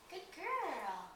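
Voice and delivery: praise. Real, not acted. Praising the dog.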